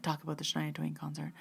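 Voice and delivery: soft, insistent inner prompt; silent urging. Retold or imitated. Audience member willing Bree to mention it, hushed urging. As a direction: murmur it as a willed thought, repeated.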